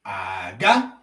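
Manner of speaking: Aga starts low, then rises; low then rising Aga. Shown as a demonstration. Teacher shows Igbo tone, Aga low rising. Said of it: "look at their tone marks".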